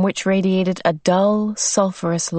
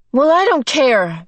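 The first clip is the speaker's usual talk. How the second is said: defiant; 7 semitones higher, 4 dB louder than usual. A narrator's reading. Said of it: "Meg said defiantly".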